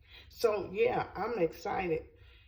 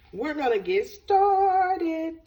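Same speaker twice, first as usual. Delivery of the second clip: excited; 9 semitones higher, 7 dB louder, slower than usual. Real, not acted. Starting the cooking.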